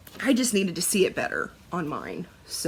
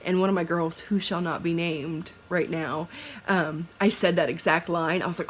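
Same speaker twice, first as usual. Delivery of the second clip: normal talking voice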